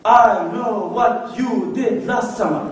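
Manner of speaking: very slow trailer voice; slow dramatic title. Shown as a demonstration. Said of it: "اسمه طويل لله".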